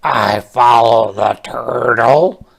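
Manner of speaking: loud frog voice; a cheerful frog. Acted. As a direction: frog following along. Frog speaks in story.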